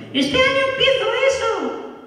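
put-on absurdly high, slow child voice; hammed-up little nephew, sky-high; nephew's ridiculed voice. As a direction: stretch it out in a tiny squeaky kid's pitch. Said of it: "se ríen de él en clase por el, la voz".

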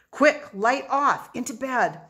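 high, loud, slow urgent order; urgent command. A narrator's reading. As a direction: hurry your friend before she comes in. Boy hurries before mother arrives.

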